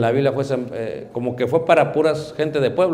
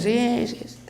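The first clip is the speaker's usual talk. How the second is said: softer, half-whispered absent answer; mumbled distracted reply; 9 semitones higher, 7 dB quieter, slower than usual. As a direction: answer without really listening, trailing away.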